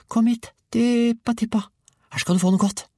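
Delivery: put-on very high boy's animal call; coaxing a hen. A narrator's reading. Georg offers the hen something nice, high coaxing. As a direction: coax it over, high and luring.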